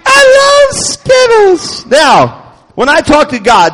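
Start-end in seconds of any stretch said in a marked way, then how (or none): 0.0-1.8 very high, loud, stretched-out squeal; hammed-up childish rapture; comic ecstatic outburst. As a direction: wail your love, dragging every word out. Comic outburst after mock prayer.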